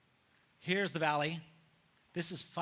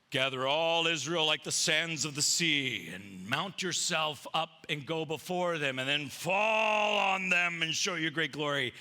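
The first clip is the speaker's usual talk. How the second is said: slow, sweeping mock-heroic proclamation; grand royal counsel; 3 dB louder, slower than usual. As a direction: stretch it out like a pompous battle speech. Hushai's grand counsel, acted.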